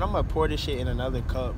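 usual speaking voice

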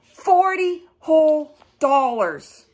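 high, loud and slow; big slow reveal for the kids; triumphant reveal. Acted. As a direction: announce the total like a prize.

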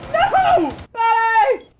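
high cry of dismay; anguished cry. Real, not acted. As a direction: cry out for a fallen teammate. Teammate dies in game.

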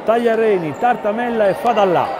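measured basketball commentary, building tension; live play-by-play. Real, not acted. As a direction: call the final seconds steadily.

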